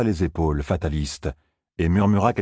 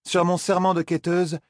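put-on higher, solemn young woman's vow; 11 semitones higher than usual. [name] swears to [name], higher and solemn. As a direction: swear it with a lifted, earnest voice.

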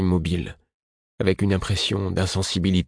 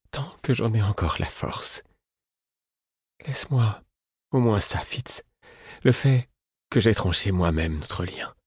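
broken voice; 4 semitones higher than usual. A narrator's reading. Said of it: "murmura-t-il d'une voix brisée".

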